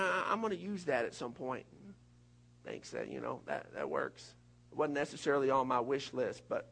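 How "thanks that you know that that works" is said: much lower, softer, half-voiced shrug; unimpressed thanks. Ungrateful believer thanking God for Jesus, low and unimpressed. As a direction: thank him like it's socks.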